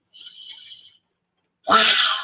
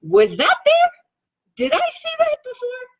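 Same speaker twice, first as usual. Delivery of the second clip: high, loud, baffled double take; startled rereading voice; mock surprise; 3 dB louder than usual. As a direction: act amazed, as if the page just changed. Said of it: "I look at it and go".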